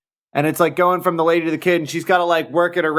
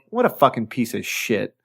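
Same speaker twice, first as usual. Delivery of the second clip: disgusted; 6 semitones lower than usual. Retold or imitated. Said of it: "real disgustedly".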